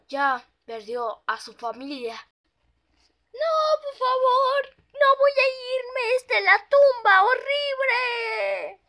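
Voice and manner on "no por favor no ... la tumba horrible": high, loud, sobbing pony voice; crying plea. Acted. The pony begs the ghost not to take her, crying high and loud. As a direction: beg through tears, loud.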